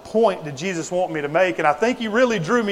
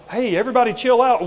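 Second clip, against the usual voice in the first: mock laid-back; 6 semitones higher, faster than usual. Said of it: "it's that mentality of".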